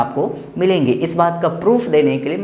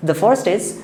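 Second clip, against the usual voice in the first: usual speaking voice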